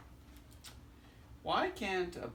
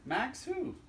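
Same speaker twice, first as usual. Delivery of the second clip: much higher, bright ask; high questioning voice; 12 semitones higher than usual. Acted. Answering the knock-knock joke, high and questioning.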